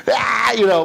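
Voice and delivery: loud, high, crying laughter; high wheezing laugh. Real, not acted. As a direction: lose it completely laughing. Laughing at the memory.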